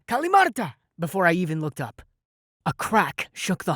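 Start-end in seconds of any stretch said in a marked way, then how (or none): 0.1-0.7 high, loud screaming cry; screamed spell. A narrator's reading.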